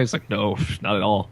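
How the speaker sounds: ordinary delivery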